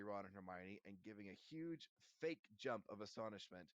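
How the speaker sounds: plain reading voice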